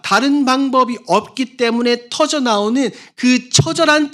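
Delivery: high, loud, impassioned preaching; fiery preacher crying out; preaching at full force. Acted. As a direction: pour out the sermon at full force. Impassioned sermon climax.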